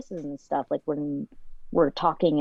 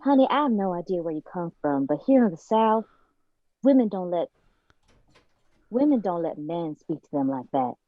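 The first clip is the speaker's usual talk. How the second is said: Southern accent. Shown as a demonstration. Guest shows the host a Southern line, in a Southern accent. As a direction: scold sweetly in a Southern accent. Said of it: "let's go with Southern accent first".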